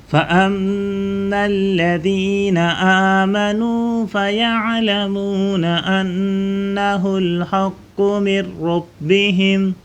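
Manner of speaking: melodic recitation, drawn out; Quran recitation. Acted. Teacher recites the verse for pupils, melodic chant.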